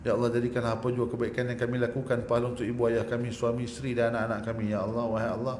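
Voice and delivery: low, soft, earnest prayer voice; pleading prayer chant; closing prayer. Acted. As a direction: lead the congregation in humble supplication.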